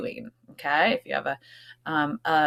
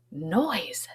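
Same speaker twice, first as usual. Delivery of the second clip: breathy realization; 6 dB quieter than usual. Acted. Mouse gets an idea.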